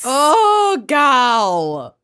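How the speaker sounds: hammed-up Minnesota "oh gal"; broad Upper Midwest exclamation; Minnesotan accent. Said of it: "unleash their worst Minnesotan accents".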